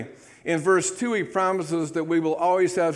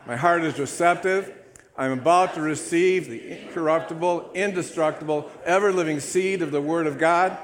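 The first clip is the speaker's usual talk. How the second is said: booming church declaration; loud group declaration; 2 semitones higher, 4 dB louder than usual. Acted. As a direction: recite it loud and bold like a creed. Congregation Bible declaration recited.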